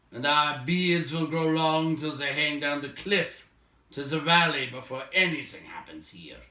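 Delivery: a dwarf fed up with waiting; loud grumbling dwarf. Acted. Dwalin grumbles about waiting.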